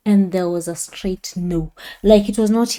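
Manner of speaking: normal talking voice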